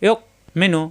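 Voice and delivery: "ইয়ক" stopped short, gap before "menu"; "ইয়ক" with a hard glottal stop; caught in throat. Shown as a demonstration. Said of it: "গলার ভিতরে আটকে যাবে".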